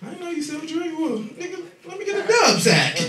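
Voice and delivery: high, squeaky eager voice; mock excited. Comic asking his brother for weed, high eager voice. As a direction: pitch way up like a giddy buyer.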